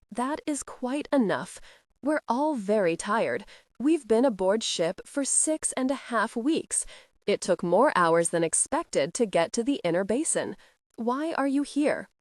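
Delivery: ordinary delivery, nothing marked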